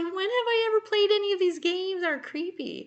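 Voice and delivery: laughing at herself; laughing protest. Real, not acted. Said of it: "always respected your husband's intelligence".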